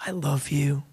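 very soft, low, tender reassurance; tender father. Acted. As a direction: say it hushed and low, father to child. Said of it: "to hear from their father".

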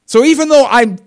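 high, loud whiny voice; mock vain Saul. Pastor mocking Saul's pride, high loud whine. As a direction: play Saul's vanity big. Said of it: "He's like".

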